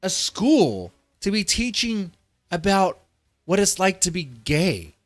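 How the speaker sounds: worked-up, high-pitched angry rant; heated, incredulous tirade; getting heated. Real, not acted. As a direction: rant in real outrage, voice climbing. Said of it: "I'm getting fucking heated".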